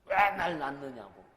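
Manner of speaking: put-on loud, whiny teen complaint; sulky child yelling at mother; mock yell from a child. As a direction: throw it back at the mother like a sulky kid. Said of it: "뭐라 그래요".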